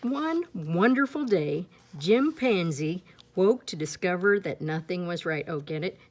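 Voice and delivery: ordinary delivery, nothing marked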